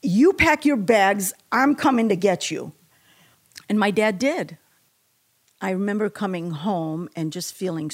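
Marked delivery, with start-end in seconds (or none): 0.0-2.7 retold livid father on the phone. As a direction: lay down the order like an angry dad. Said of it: "And he was livid".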